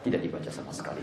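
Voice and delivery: very soft whisper; near-silent recitation. Acted. Over-zealous imam acts out not reciting at all, whispered. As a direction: say it almost without sound.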